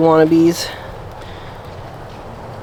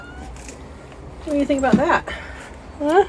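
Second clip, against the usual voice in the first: pet talk; 8 semitones higher, 6 dB quieter, slower than usual. Real, not acted. Cooing to the pet.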